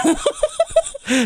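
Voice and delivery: shrieking giggle, very high; high squeal laugh. Real, not acted. Host laughing at the traffic-voice impression, high squeal.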